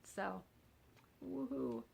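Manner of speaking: ordinary delivery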